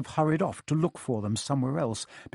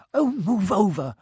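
impatient, higher and louder snarl; 7 semitones higher, 5 dB louder than usual. A narrator's reading. Hermione taking over at the locked door, snarling. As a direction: shove in with an irritated order. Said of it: "Hermione snarled".

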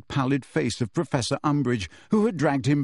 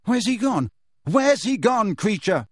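higher, pressing questions; urgent demand; 8 semitones higher, 3 dB louder than usual. Acted. Harry pressing the elf for Sirius's whereabouts, urgent. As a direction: demand an answer fast. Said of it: "Harry demanded".

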